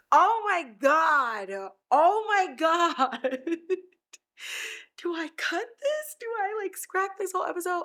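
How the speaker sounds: high wail sliding into a teary laugh; real tearful overwhelm. Real, not acted. Host wondering whether to scrap the episode, tearful. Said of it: "I'm getting so emotional".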